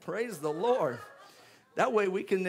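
usual speaking voice

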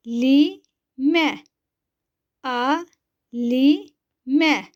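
each syllable said alone with a pause after it. Shown as a demonstration. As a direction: spell out the word slowly for pupils to repeat. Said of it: "Ben okuyorum".

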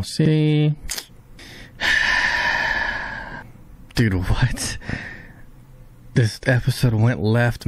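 ordinary talk

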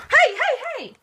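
high, loud warning calls; alarmed shout. Real, not acted. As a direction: shout urgently to break up a fight. Calling out at fighting pets.

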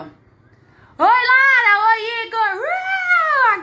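high, loud happy calling out; excited greeting. Real, not acted. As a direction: call out to friends with delight. Greeting viewers by name excitedly.

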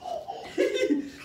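high breathless laugh; excited laugh. Real, not acted. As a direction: burst out laughing in thrill. Thrilled reaction during play.

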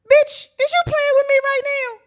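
high, very loud incredulous shout; outraged yell. Retold or imitated. Storyteller to friend who just fell, outraged yell. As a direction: explode at her in disbelief. Acted outburst as friend falls.

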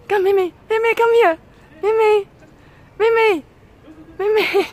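very high, loud insistent call; calling the dog. Real, not acted. Owner urging her scared dog to come, high and loud.

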